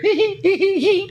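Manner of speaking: very high-pitched hee-hee giggle; mock high giggling; imitated laughter. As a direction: titter in a squeaky falsetto. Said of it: "I hear laughter".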